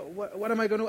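stammered, worked-up plea; worked-up panic. Retold or imitated. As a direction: blurt it out in mock panic. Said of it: "started getting worked up".

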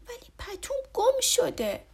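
put-on high, whiny childlike voice; a child on the edge of tears; upset little boy. A narrator's reading. Boy tells his father the blanket is lost, upset. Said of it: "با ناراحتی گفت".

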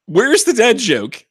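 high incredulous protest; indignant disbelief. Real, not acted. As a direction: squeak out mock outrage. Mock outrage at dad joke.

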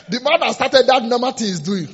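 loud, high talk through laughter; laughing. Real, not acted. Preacher joking with congregation about failed resolutions, laughing. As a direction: crack up while still talking.

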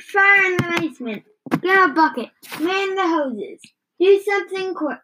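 high, fast, frantic shouting; panicked yelling. A narrator's reading. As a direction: bark out orders in a panic, fast. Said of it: "old yelled".

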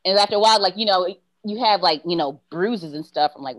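ordinary talk

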